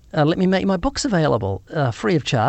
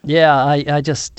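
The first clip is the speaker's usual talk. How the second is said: ordinary delivery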